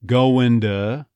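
"going to" as "guh-oh-in-duh"; slow "going to", no G, T turned to D. Shown as a demonstration. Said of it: "the T on to becoming more D-like".

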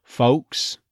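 "Folks" with L melted into w; vanishing L "Folks". Shown as a demonstration. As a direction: neutral O and no clear l in "Folks". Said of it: "the L sort of disappearing".

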